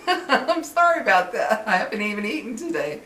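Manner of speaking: giggling high and loud through an apology for burping; laughing apology. Real, not acted. Vlogger laughing to her viewers about her burp, real giggly apology. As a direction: laugh it off, embarrassed and bright.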